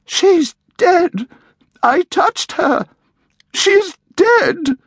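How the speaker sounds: very high, panicked cries; hysterical woman. A narrator's reading. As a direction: shriek it in horror over the body. Woman finds the body.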